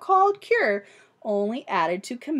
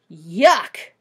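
loud disgusted yuck; 5 semitones lower, 8 dB louder than usual. Acted. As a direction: pretend to hate it. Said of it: "she said".

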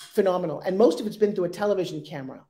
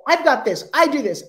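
louder, pushy salesman blast; mock pushy pitch; 4 semitones higher, 6 dB louder than usual. People pitching an investor, mild mock yelling. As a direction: hammer the pitch at them like a bad founder. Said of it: "I don't need to be yelled at".

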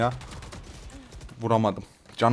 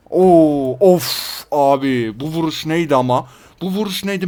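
loud, drawn-out Oo, then higher amazed questions; stunned exclamation; 3 semitones higher, 7 dB louder, slower than usual. Real, not acted. Gamer stunned by the shot that killed him, loud disbelief.

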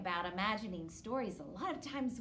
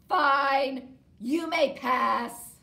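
grumpy troll; 4 semitones higher, 13 dB louder, much slower than usual. Acted. Troll gives in.

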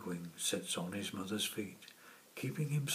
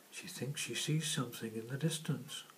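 ordinary delivery

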